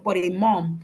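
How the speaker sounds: normal talking voice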